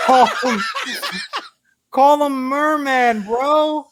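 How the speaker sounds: mock exasperated suggestion to the writers; exasperated advice. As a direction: beg them to see sense.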